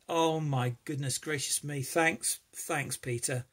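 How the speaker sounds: usual speaking voice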